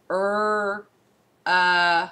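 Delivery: slow drawn out er, uh sounds; sounding out. Shown as a demonstration. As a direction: stretch the short u for children. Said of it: "How does short U sound?".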